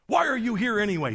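high, agitated demand; diverting challenge. Retold or imitated. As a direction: imitate someone deflecting with a pointed question. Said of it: "He starts diverting".

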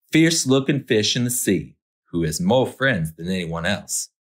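usual speaking voice

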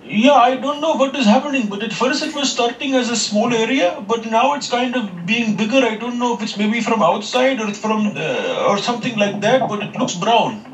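high put-on foreign accent. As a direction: play a polite, worried tenant. Caller plays a new tenant.

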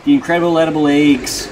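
loud slogan with "eggs" as "aygs"; hammed-up "aygs" for "eggs"; mock regional pronunciation. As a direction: belt it out with a long AY in "eggs". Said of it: "Who the fuck says it like that".

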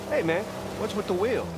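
a hint of a puzzled bartender; joke bartender. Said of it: "So the bartender goes".

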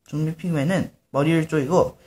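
ordinary delivery, nothing marked

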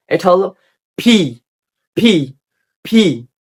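ফি with a strong puff of air on the p; aspirated P demo. Shown as a demonstration. Teacher showing English P with breath. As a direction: blow air out on ফি. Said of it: "বাতাস বের হবে".

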